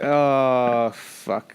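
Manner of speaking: groaned "oh" sinking to a whisper; trailing off, fed up; deflated dismay. Acted. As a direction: let it die away in weary dismay. Deflated reaction to British accent.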